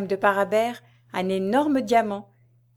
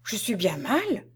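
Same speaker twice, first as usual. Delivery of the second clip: slow, weakly alarmed; mildly frightened patient, drawn out; faltering; 3 dB quieter, slower than usual. A narrator's reading. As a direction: falter slowly as if feeling ill. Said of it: "balbutia Parabère".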